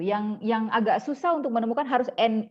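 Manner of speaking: usual speaking voice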